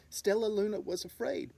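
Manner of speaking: usual speaking voice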